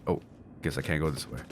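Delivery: ordinary delivery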